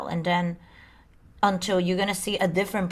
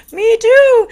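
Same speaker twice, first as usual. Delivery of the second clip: much higher, much louder excited "me too"; delighted squeal; 13 dB louder than usual. Retold or imitated. Retelling meeting Stardust at the drum circle, excited squeal. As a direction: squeal it like you just found your twin.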